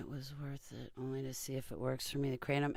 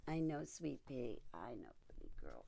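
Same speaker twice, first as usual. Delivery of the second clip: soothing the pet; 10 dB quieter than usual. Real, not acted.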